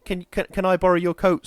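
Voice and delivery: put-on vampire girl asking for a coat; pleading little girl. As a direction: beg sweetly. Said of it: "sort of thing".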